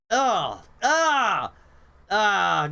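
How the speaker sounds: loud high yelps of embarrassment; cringing yelp. Real, not acted. Streamer recoiling from her own voice, loud yelps. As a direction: yelp as if burned.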